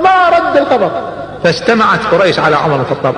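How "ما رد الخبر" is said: high, forceful narration; rousing storyteller; excited proclaiming. A narrator's reading. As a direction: tell it with fire. Umar proclaims his Islam.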